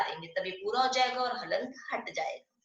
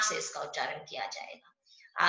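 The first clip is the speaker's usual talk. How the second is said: whisper; 5 semitones lower, 6 dB quieter than usual. Real, not acted. Teacher stressing nasal pronunciation.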